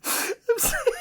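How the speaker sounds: very high, wheezy apology through giggles; can barely get it out for laughing; helpless squeaky laughter; real laughing apology. Real, not acted. Apologises while cracking up.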